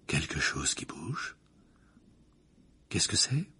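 slow, soft, suspenseful question; murmur. A narrator's reading. Professor bent over the crystal ball, murmuring. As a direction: let it hang mysteriously. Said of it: "murmura le professeur Trelawney".

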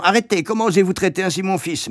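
high, quick outraged protest; indignant cry. A narrator's reading. Imagined mother defending her son, indignant. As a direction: cry out in protest without much added volume. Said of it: "en criant".

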